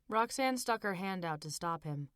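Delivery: ordinary delivery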